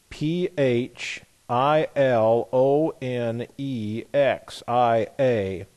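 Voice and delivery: slow, steady run of separate letters; deliberate, measured spelling; spelling out slowly. Real, not acted. Spelling the word out again.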